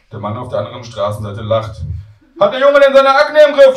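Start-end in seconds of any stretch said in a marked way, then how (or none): 2.4-3.8 put-on very high, booming old-lady holler; shouting granny. Grandmother asking about grandson's acne, loud high shout. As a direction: shout the question for all to hear. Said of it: "schreit Oma".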